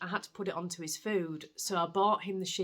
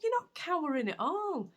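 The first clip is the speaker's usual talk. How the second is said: much higher, mock-amazed; amused surprise; 11 semitones higher than usual. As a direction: catch the cat out, delighted. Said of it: "I looked at him and I went".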